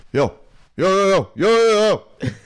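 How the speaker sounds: imitated hailing from afar; imitating calling out. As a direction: call out a repeated yo to a stranger. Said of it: "Like that".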